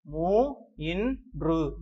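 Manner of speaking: மூ, இன், று slowly, each sound apart; syllable by syllable. Shown as a demonstration. Teacher sounding out a number for children, slow separated syllables. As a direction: stretch மூன்று into moo, in, dru.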